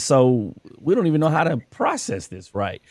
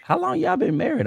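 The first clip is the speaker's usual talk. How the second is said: put-on surprised little girl.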